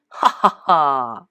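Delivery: slow, low evil cackle; villain laugh. A narrator's reading. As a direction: laugh like a tiny tyrant. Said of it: "shouted Plankton".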